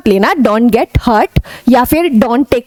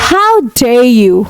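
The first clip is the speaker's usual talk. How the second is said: each word drawn out as a model; teacher sounding out "How dare you?" slowly; slow, careful "How dare you?"; 3 semitones lower, much slower than usual. Shown as a demonstration. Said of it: "इसको बोलते हैं". (धीरे-धीरे "How dare you?")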